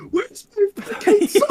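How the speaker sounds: a mother losing it over her son; high-pitched mock frantic yell. Rio frantic, calling about son.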